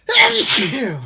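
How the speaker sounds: loud real sneeze; big explosive sneeze; sneezing. Real, not acted. Sneezing fit continues.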